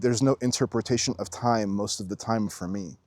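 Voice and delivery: ordinary talk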